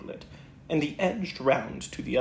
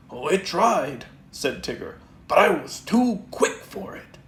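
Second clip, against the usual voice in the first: lightly voiced bouncy Tigger; a hint of excited Tigger at the mirror; 5 dB louder than usual. Tigger boasts.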